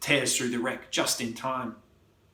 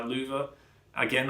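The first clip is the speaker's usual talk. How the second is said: usual speaking voice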